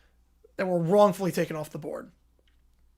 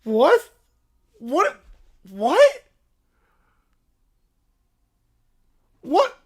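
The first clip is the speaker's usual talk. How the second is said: rising what after what, louder each time; repeated disbelief; 5 semitones higher, 5 dB louder, much slower than usual. Real, not acted. Streamer reacting to a terrible anthem, incredulous whats. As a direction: keep asking in stunned disbelief.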